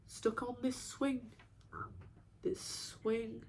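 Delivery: hushed, halting repetition; very soft, as if to oneself; stuck and withdrawn. Acted. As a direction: trail into a soft, slow murmur. Feels stuck, repeating to herself.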